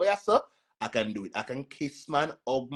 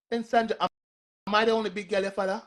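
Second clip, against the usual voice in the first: sarcastic; 9 semitones higher, faster than usual. Real, not acted. Mocking question to a viewer.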